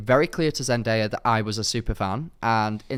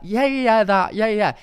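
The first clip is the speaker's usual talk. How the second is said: high, eager mock agreement; pretending to follow; 8 semitones higher, 4 dB louder than usual. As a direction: nod along loudly, faking that you understand. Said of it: "I'm, like, ad-libbing to him".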